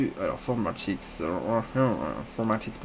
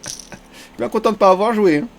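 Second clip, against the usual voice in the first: laughing relief; 9 semitones higher, 5 dB louder than usual. Real, not acted. Relieved after big all-in.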